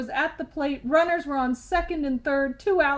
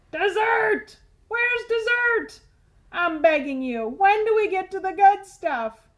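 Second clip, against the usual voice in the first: outraged tooth; 6 semitones higher, 4 dB louder than usual. A narrator's reading. Tooth complains about vegetables.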